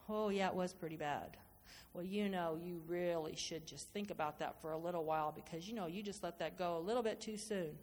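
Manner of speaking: low, hushed, coaxing doubt; tempting inner voice. Acted. The enemy whispering to the mind, low and coaxing. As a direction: murmur it low like a tempter in the ear.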